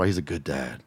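low, soft parody of a cliché; mocking cliché quote. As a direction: sneer it out as an empty phrase. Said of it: "I'm so tired of hearing people talk about".

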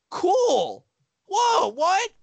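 very high squeak of surprise; dad learning what it is, very high and startled; mock shocked dad.

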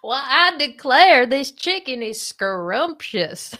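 slow, hammed-up Southern drawl; Southern accent. Shown as a demonstration. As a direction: savor it in a big Southern accent. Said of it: "say it in a Southern accent".

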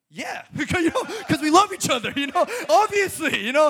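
mimicking a newlywed couple defending their tears; mock couple reply. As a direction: play them up, laughing and gushing, a bit fast. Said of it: "they're like".

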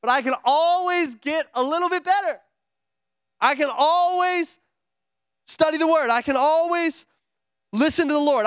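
rising, ringing preacher's build; preaching. Acted. Pastor rallying the church, full-force preaching. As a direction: preach it up to the congregation.

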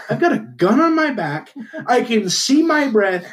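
rising, overwrought proud declaration; mock patriotic fervour. Acting out a proud American hunter.

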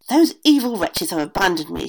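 angry witch. A narrator's reading. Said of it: "she said".

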